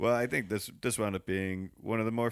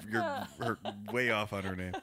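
normal talking voice

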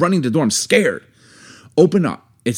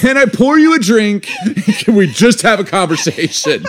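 pitched high and loud across the yard, half-laughing; retold loud plea; 10 semitones higher, 3 dB louder than usual. Wife retells getting loud in her yard, loud exasperated plea. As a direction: call out a high, exasperated invitation to the neighbours. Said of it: "got loud in my yard".

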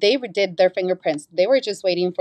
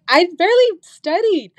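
high-pitched, louder amazed admission; giddy disbelief; 12 semitones higher, 5 dB louder than usual. Retold or imitated. Test-taker marvelling at passing, high giddy disbelief. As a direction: squeal it in amazement at your own luck.

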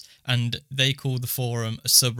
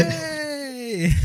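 high, drawn-out "wahey" cheer; celebratory cheer; 13 semitones higher than usual. Real, not acted. Cheering the episode number.

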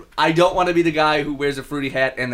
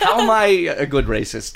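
ordinary talk

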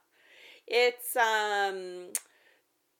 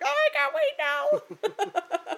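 high, slow gleeful squeal; excited squeal; 4 dB louder, much slower than usual. Retold or imitated. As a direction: squeal with delight. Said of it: "I was like".

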